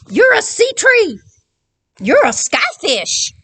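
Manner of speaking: high excited storybook shout; excited recognition. Acted. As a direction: exclaim as if spotting an old friend. Characters meet, excited recognition.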